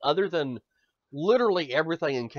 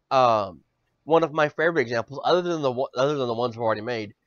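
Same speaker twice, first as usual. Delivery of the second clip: ordinary talk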